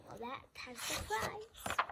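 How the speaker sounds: usual speaking voice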